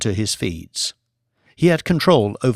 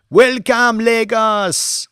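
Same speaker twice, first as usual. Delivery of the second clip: high, loud ringing call to the crowd; rally leader's greeting; 11 semitones higher, 4 dB louder, much slower than usual. A narrator's reading. Mamadou greeting huge crowd of supporters, loud high rally call. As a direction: greet the whole city from the rostrum. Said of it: "Voice perfect, too".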